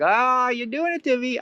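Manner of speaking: high, drawn-out mock wail; acted feeling it. Feeling the lie do it to you, high mock wail. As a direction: play the hurt up high and slow. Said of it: "you might be feeling it".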